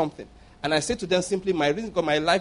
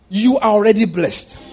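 emphatic declaration; 4 semitones higher, 7 dB louder than usual. Real, not acted. Preaching declaration.

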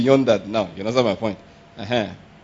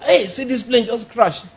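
mock alarm; 6 semitones higher, slower than usual. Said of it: "If there's something like".

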